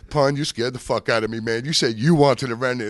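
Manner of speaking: loud, high acted-out complaint; exasperated yell, re-enacted; retold relieved scolding. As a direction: holler at a friend who gave you a scare. He scolds Pun after the scare.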